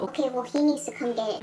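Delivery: a hint of a curt office lady; imitating clerk.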